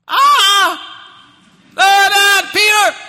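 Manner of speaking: very loud, high-pitched scream of terror; acted shriek. Preacher acting frightened disciples seeing a ghost, acted shriek. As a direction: shriek it out at the top of the voice. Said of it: "They shrieked".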